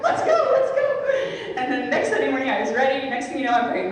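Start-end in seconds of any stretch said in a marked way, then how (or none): 0.0-1.0 high, loud, slow eager hurry-up; eager urging. Retold or imitated. Hurrying her mother to Sunday Mass, high loud eager urging. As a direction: chivvy a sleepy parent out the door.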